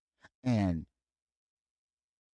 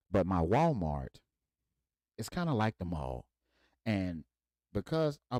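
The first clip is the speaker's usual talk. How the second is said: ordinary delivery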